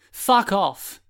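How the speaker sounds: high, loud angry snap; angry retort. A narrator's reading. Fallen boy snaps at the glasses boy, angry. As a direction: spit it back at him.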